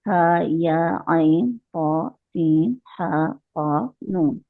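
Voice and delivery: slow, drawn-out recitation of the letters; Quranic recitation. Shown as a demonstration. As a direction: recite each letter separately and slowly. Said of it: "ये वाले नीचे जो लिखे हुए ना".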